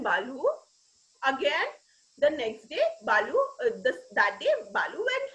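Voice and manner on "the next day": ordinary delivery, nothing marked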